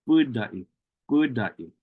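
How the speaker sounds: budai with a long stressed opening U; lengthened first U. Shown as a demonstration. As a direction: hold the U of budai. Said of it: "is lengthened".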